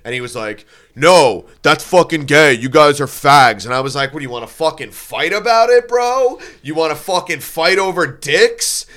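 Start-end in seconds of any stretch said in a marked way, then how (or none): 0.9-3.6 loud, emphatic put-down; retold angry retort. Singer snapping back from the stage, loud angry retort. 4.1-8.9 high, loud, rising taunt; confrontational challenge. Retold or imitated. Drunk heckler challenging the singer to fight, high loud taunt.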